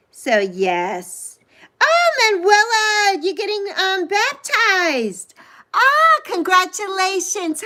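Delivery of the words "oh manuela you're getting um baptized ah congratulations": delighted surprise. Real, not acted. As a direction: gasp with joy at the news. Reading viewer's baptism news.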